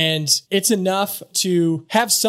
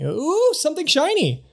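distracted bird mother; 11 semitones higher than usual. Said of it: "my mother used to tell me".

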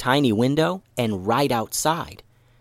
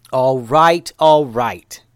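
loud call to cut the laughter; irritated yell; 2 semitones higher, 7 dB louder, much slower than usual. A narrator's reading. Pied ninja yells over everyone's laughter, fed up. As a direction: raise the voice, fed up. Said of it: "yelled Boulise".

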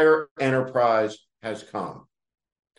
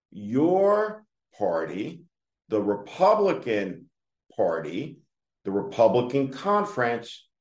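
drawn-out, measured delivery with pauses between phrases; slow, deliberate repeat; 3 semitones lower than usual. Shown as a demonstration. Commentator repeating the blame for a congressman, slowly and deliberately. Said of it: "I'm gonna say this again slowly".

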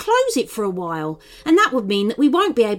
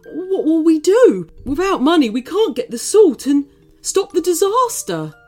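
high, loud worried wail; fretting; 9 semitones higher, 5 dB louder than usual. A narrator's reading. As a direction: fret with rising panic. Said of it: "fretted Jumbo".

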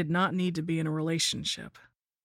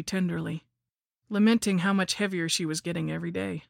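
ordinary delivery

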